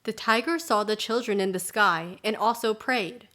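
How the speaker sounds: ordinary delivery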